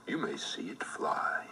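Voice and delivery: plain reading voice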